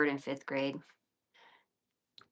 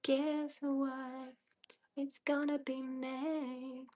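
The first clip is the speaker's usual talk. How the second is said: high whispery meme voice; 9 semitones higher, 7 dB quieter, much slower than usual. Pretend. Joking to stream viewers in meme voice. Singing the [name] meme.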